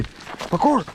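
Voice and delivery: high silly yell of parkour; goofy shout. Pretend. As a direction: shout it like a cartoon stunt kid. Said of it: "I'm just being stupid".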